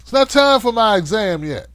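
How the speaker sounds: loud, high, offended complaint; mock indignant yell. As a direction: yell back like someone interrupted mid-checkup. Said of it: "It's like".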